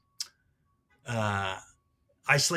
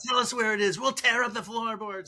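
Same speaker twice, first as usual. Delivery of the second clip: high, eager offer to help; 10 semitones higher, 3 dB louder than usual. Retold or imitated. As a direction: pitch it up, eager to please. Retelling his own words at the arrest.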